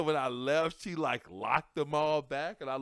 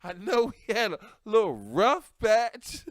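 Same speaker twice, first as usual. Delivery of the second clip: loud, higher, sweet-talking pickup line; smooth flirting; 6 semitones higher, 9 dB louder than usual. Retold or imitated. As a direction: charm her back after a fight. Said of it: "I was looking like".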